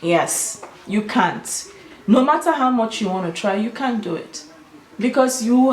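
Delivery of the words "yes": usual speaking voice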